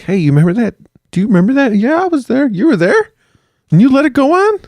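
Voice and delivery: very high mock voices, rising; squeaky interrogator. Hunters questioning a witness, squeaky and accusing. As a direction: play it shrill and accusing.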